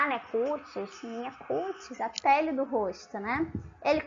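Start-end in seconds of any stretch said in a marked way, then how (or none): 0.0-2.0 put-on older person. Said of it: "têm a mania de falar".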